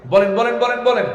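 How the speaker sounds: loud, high, repeated urging; urgent prodding. Real, not acted. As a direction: press the crowd hard for an answer. Pressing the crowd to answer. (উঁচু গলায় জোর তাগাদা)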